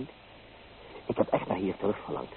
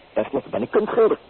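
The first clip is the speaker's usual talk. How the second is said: much higher and louder, ringing out; proud declaration; 12 semitones higher, 9 dB louder than usual. Acted. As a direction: announce it like a banner you wave. Proudly defends his freedom.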